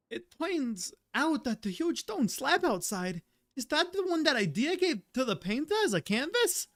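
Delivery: high-pitched, bouncy game companion voice; Paimon. Acted. Streamer reads game companion's dialogue, high gag voice. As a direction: pitch it up high with a sing-song swing. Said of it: "while I'm, like, reading Paimon's dialogue".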